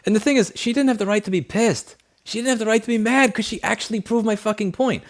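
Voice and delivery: high-pitched, exasperated insistence; indignant rant. Real, not acted. Streamer argues his late date had no right, indignant.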